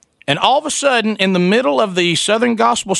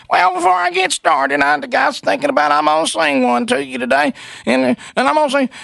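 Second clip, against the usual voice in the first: high, mock churchy wife voice; mimicking the wife; 7 semitones higher than usual. Preacher's wife announcing her song on air, high mock voice. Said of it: "she goes".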